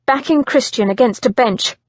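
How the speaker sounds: plain reading voice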